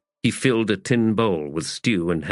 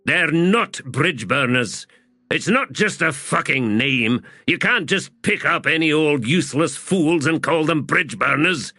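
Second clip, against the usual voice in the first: higher, heated rebuke; angry protest; 8 semitones higher than usual. Acted. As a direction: throw the claim back at him angrily. Fiddler argues angrily after shoving Hedge.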